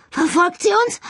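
high, loud panicked question; Holly, fleeing the ghost, asks in panic; frightened. Acted. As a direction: ask in sheer panic, voice up high. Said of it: "fragte Holly ängstlich".

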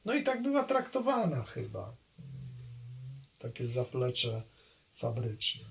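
normal talking voice

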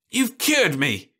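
high, slow cry of shock; cried out. A narrator's reading. Jim crying out to his wife. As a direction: cry it out in dismay. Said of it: "he cried".

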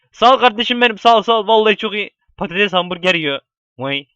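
ordinary talk, nothing marked

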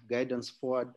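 usual speaking voice

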